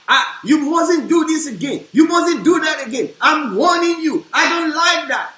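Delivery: loud, high scolding husband; hammed-up furious husband shouting; angry husband. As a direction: bark the warning at your wife. Husband scolding wife, example.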